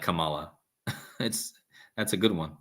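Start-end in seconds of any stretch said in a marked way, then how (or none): 0.0-0.5 Kamala echoed as kum-AH-luh; sound-alike pronunciation. Shown as a demonstration. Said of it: "sounds exactly like".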